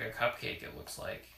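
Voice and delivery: ordinary delivery, nothing marked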